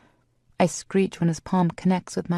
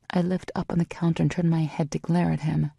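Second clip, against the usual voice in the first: ordinary delivery, nothing marked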